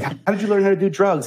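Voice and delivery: playful imitation of an ad dad; father voice. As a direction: ham up the stern dad's question. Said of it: "the guy was like".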